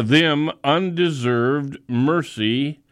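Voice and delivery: usual speaking voice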